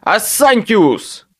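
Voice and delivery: high, slow shouted incantation; shouted spell. A narrator's reading. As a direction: bellow the spell. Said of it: "и выкрикнул".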